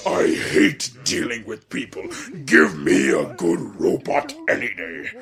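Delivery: low, slow villain voice; Eggman. Retold or imitated. Quoting a favourite comic-dub line, imitating Eggman. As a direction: draw it out as a low, rough character impression. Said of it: "I love Eggman's line".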